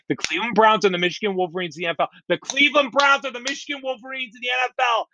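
fast, drilling the same line over and over; fast, relentless chant meant to beat the point into fans; rapid-fire repetition. Shown as a demonstration. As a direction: rattle it off fast to hammer it in. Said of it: "I'm gonna say this five times because you need to be beaten down".

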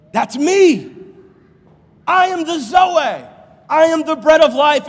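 loud, high triumphant declaration; booming declaration of identity; triumphant proclamation. Acted. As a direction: proclaim it with power. Jesus voiced triumphantly.

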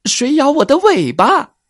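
high, startled fox shriek. Acted. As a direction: yelp it out like a bitten fox. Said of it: "狐狸叫了起来".

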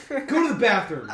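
imitating a mom's furious command; angry mom. Mom ordering her son to the bathroom, angry.

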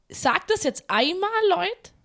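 loud hammed-up outrage; mock warning to the prankster; mock angry.

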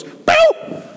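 high squeaky boop; a cartoon pop as joy vanishes; joy dropping boop. Preacher to congregation, joy dropping, high squeaky boop. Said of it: "My joy goes".

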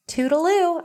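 high, sing-song toodaloo; playful sign-off. Real, not acted. Host signing off to listeners, playful and high. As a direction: toss it off lightly and cutesy.

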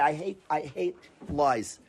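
ordinary talk, nothing marked

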